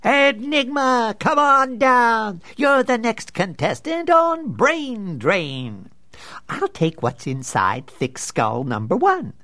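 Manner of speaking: high, slow barking game-show host. Acted. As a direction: boom it like a manic quiz host.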